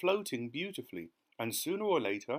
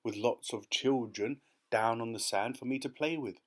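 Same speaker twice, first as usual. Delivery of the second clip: ordinary delivery, nothing marked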